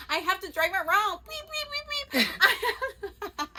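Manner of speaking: retold giddy squeal with high beeps; giddy excitement. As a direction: squeal with joy like a happy kid. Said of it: "I was like".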